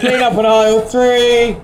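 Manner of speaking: announcer voice through a mic; shop tannoy announcement. Pretend. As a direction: call it out like a store tannoy. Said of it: "talking like a train announcer".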